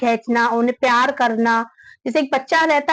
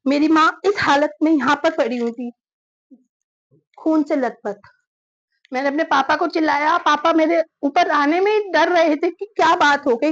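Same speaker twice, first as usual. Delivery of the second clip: ordinary talk, nothing marked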